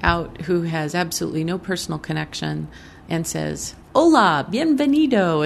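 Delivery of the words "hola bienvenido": loud, high mock Anglo-accented Spanish greeting; terrible Spanish accent. Woman imitates politician with no personal connection, terrible Spanish accent. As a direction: imitate a stranger's badly accented Spanish, pitched up. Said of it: "say it in a really terrible accent".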